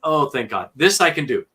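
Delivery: retold relief. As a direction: relive the relief with emphatic voice. Retells relief at easier accent.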